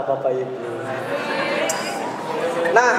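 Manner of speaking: normal talking voice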